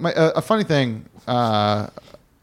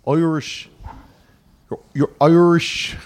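drawn-out Irish vowels; attempted Irish accent; slower than usual. Shown as a demonstration. Host trying out the word Irish, hammy Irish accent. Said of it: "Is that right?".

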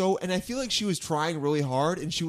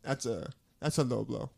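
fake Somali accent; 6 semitones lower than usual. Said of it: "how bad is my accent?".

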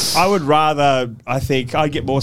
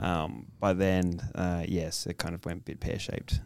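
trailing off softer to unvoiced; fading to whisper; 7 semitones lower, 13 dB quieter, slower than usual. Real, not acted. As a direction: let it die to a whisper. Sad admission, fading.

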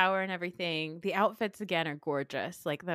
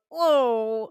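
louder drawn-out adoring aww; adoring coo; 4 semitones higher, 10 dB louder than usual. Shown as a demonstration. Host melting at the cute couple, adoring. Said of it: "put my hands under my chin and then go".

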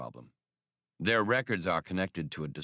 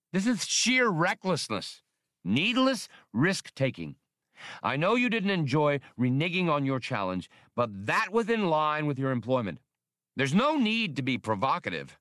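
agitated protest; 7 semitones higher than usual. Acted. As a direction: protest the recklessness, worked up. Spray protests, shaking head.

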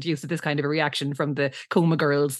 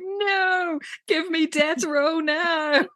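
high, slow, hammed-up despair; dramatic plea; 10 semitones higher, much slower than usual. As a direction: beg for death row like a tragic diva. Liz begging for death row.

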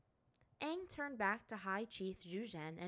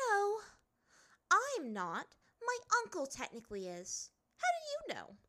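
high, sharp princess voice; a proud girl brushing it off; haughty princess; 12 semitones higher, 6 dB louder than usual. A narrator's reading. Azula denies being heir.

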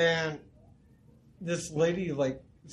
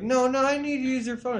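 high, louder insistent plea; mimicking the lady; 10 semitones higher, 5 dB louder than usual. Imitating the lady insisting at his door, high and loud. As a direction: ham up a needy pushy stranger. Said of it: "she's like".

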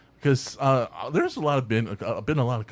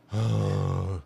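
long, low acted sigh; mock weary exhale; exasperated sigh; 5 semitones lower than usual. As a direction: sigh heavily like a fed-up cop. Acting out the fed-up cop.

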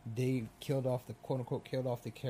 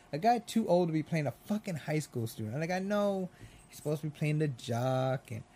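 usual speaking voice

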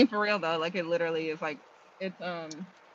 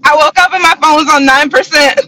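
ordinary talk

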